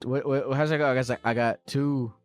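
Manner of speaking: usual speaking voice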